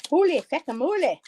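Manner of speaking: high, loud mock Irish exclamation; Jackie's Irish accent. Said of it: "saying that in her Irish accent".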